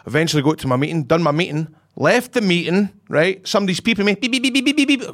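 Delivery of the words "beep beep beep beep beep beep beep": high beep beep imitation; imitated beeping. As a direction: mimic a car alert beeping. Imitating a phone alert.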